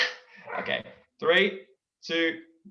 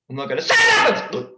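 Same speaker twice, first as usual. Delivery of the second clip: high, loud refusal; mock whine at the friend; loud whiny refusal; stubborn yell; 10 semitones higher, 8 dB louder than usual. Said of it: "She goes".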